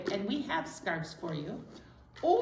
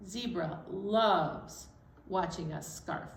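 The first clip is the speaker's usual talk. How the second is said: ordinary delivery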